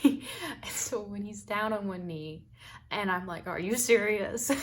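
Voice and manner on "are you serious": loud, rising question of disbelief; incredulous. Retold or imitated. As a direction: re-enact stunned disbelief, laughter close behind. Retold disbelief at the proposal.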